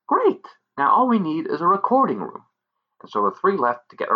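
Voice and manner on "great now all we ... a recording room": high, gleeful fairy voice; chirpy delighted pixie. Acted.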